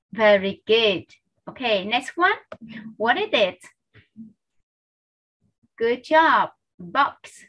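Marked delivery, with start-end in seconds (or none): none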